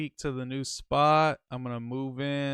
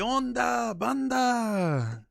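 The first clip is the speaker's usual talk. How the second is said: high, hammed-up NorCal Chicano greeting; NorCal Chicano accent; 9 semitones higher, 3 dB louder, much slower than usual. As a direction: greet the crowd like a NorCal homie. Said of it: "Why does this fool talk funny?".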